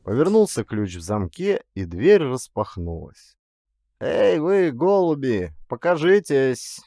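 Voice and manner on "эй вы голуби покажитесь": louder, higher mock-gentle order; commanding call. A narrator's reading. Clerk orders the vagrants to show themselves, commanding call. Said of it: "командовал писарь".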